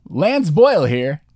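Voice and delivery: loud, high, slow announcer; Lance Boyle. Retold or imitated. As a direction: draw it out like a hype host. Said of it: "His name is Lance Boyle".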